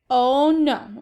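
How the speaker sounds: put-on startled boy refusing sandals; protesting. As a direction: blurt it out in protest. Peter refuses sandal shoes.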